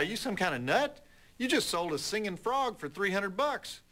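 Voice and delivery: rising pitch, mock disbelief building; incredulous bartender. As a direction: scold him like he's lost his mind. Bartender scolds guy in joke.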